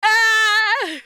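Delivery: high sudden yelp; startled yelp. Real, not acted. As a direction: yelp in surprise. Dog licking streamer.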